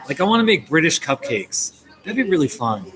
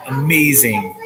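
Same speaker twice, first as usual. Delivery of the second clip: ordinary talk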